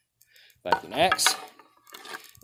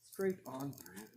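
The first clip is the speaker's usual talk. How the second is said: much softer, a muttered repeat to himself; quiet echo; 9 semitones higher, 16 dB quieter, slower than usual. Real, not acted. Tester repeating the result to himself, softly. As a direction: let it drop away as an aside.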